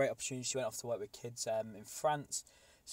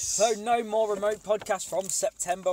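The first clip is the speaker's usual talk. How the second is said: high, loud announcing voice; announcer proclamation; 9 semitones higher, 8 dB louder than usual. Pretend. Podcaster repeating his big news to listeners, announcer proclamation. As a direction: belt out the news like a mock announcer.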